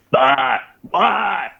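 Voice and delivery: wordless mock shouts, climbing high; imitated DI barking. Instructor going to every individual, imitated wordless shouting. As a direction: imitate yelling in each recruit's face. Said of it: "went to every fucking individual and was like".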